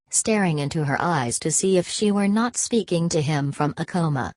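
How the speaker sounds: ordinary delivery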